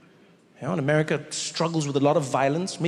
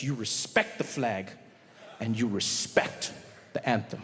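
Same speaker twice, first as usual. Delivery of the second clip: slow, low and hushed reverence; solemn; 8 semitones lower, 7 dB quieter than usual. Acted. Comic stating America's sacred rules, low and solemn. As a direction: say it like a sacred rule, slowly. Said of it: "solemn rule of America".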